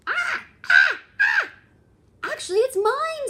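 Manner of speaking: very high, loud screeching cries then claim; eagle screech. Acted. Eagle snatching the egg in the story, screeching. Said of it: "says the eagle".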